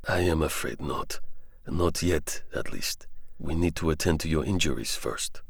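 calm French doctor answering the soldier. Acted. Xavier explains gently.

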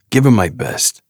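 a hint of a gruff New Yorker; gruff New York accent. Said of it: "gruff New York accent".

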